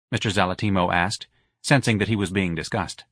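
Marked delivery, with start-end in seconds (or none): none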